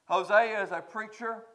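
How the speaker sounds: usual speaking voice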